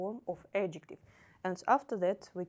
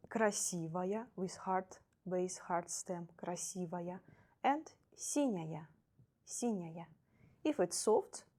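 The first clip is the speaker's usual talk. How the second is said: ordinary delivery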